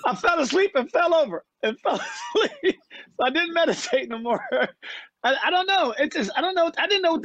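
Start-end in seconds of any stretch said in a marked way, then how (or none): 0.1-2.8 laughing through it. Real, not acted. Laughing while telling.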